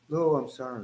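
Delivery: low, very soft apology; calm. Acted. Practicing calmness toward a heckler, hushed apology. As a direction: drop right down, refusing to react. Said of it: "I'm actually practicing to be calm".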